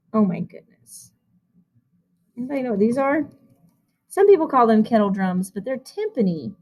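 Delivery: usual speaking voice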